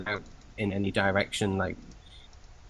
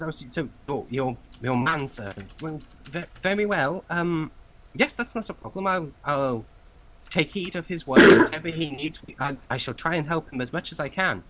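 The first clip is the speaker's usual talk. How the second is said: higher, stammering polite clerk; deferential clerk; 6 semitones higher, 4 dB louder than usual. Acted. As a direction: yield politely, voice pitched up. Clerk humbly agrees.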